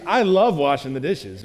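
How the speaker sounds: slow, dry mock delight on "I love washing the dishes"; sarcastic. Mom to her family, sarcastic about the dishes. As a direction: sound like you'd rather do anything else. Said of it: "say things in sarcastic ways".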